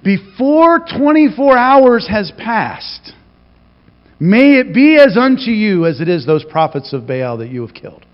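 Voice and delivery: a queen's threat, drawn out; Jezebel's threat. Retold or imitated. Jezebel threatening Elijah, high slow vow. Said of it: "Jezebel says".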